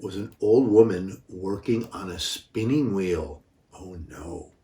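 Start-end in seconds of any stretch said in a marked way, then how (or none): 3.7-4.5 hushed aside. Pretend.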